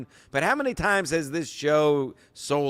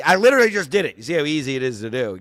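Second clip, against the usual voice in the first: normal talking voice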